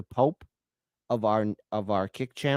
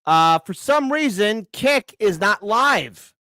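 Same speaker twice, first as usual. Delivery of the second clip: much higher and louder, shouting in real anger; real furious outburst; 11 semitones higher, 9 dB louder than usual. Real, not acted. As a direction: blow up at the stream going down. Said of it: "Oh, I am pissed off".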